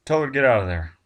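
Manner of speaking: loud, sharp command; urgent order. Acted. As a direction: bark it at Tina, no delay. Barnes orders Tina urgently.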